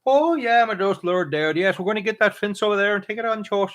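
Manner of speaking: high-pitched, hammed-up Minnesota accent; thick Minnesota accent. Retelling how a delivery guy from Minnesota talked, hammed-up Minnesota accent. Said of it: "He was totally like".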